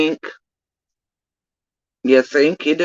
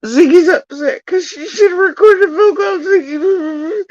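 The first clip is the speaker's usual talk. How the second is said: much higher, whiny fake weeping; mock crying; 14 semitones higher than usual. Mocking Ziggy crying on stream in a high fake-weepy whine. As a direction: ham up a sob story to ridicule her.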